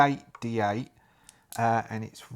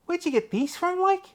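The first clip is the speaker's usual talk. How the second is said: much higher, eager put-on voice; high pleading Jack. Jack begs Staff to let him come, in a high eager voice. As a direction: beg to come along, pitched up and eager.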